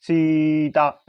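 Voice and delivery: सीता with the ई and आ stretched long; drawn-out vowel. Shown as a demonstration. Teacher stretching सीता to demonstrate the long-sound mark. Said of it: "ऐसे बोलते हैं ना".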